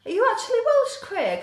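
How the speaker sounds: high, loud surprised question; genuinely taken aback. Real, not acted. Surprised to learn Craig is Welsh.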